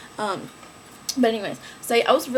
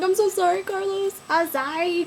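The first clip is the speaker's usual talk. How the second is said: higher pleading sorry; high pleading apology; 9 semitones higher than usual. Retold or imitated. Apologizing in retold crash scene.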